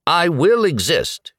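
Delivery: high, emphatic resolve; resolute, emphatic statement; firm declaration. A narrator's reading. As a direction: declare it firmly, pitched right up. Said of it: "he stated firmly".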